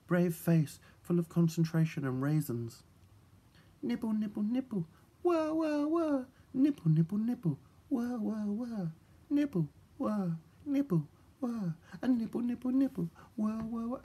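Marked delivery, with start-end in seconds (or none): 3.8-14.0 small busy nibbles with little wah sounds; tiny nibbling creature. A narrator's reading. Nibbling creature noises in dream.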